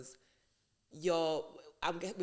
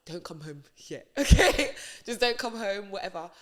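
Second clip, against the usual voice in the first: dismissive order broken by laughter; laughing through it; 11 dB louder than usual. Retold or imitated. David telling Uriah to stay away, laughing. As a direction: laugh while brushing him off. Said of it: "David's like".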